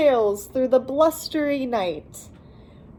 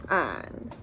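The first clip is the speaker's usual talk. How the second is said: sleepy drop; 13 semitones lower, 8 dB quieter than usual. Acted. Bear snoring, voice drops.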